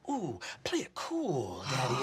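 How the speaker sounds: low, soft, slow purr; slinky taunt. Acted. Biker purring at the player as he leaps the axe, low and soft. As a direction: coo it like a smug greaser.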